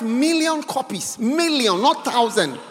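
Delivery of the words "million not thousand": ordinary delivery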